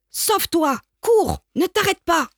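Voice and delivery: shouted chase. Acted. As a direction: yell him out the door. People chasing the dog away. (les gens chassent le chien en hurlant, voix très aiguë)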